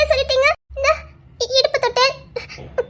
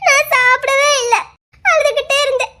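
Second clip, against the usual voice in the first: crying complaint. Acted. Woman telling the man she starved and wept, crying. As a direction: cry out how she suffered.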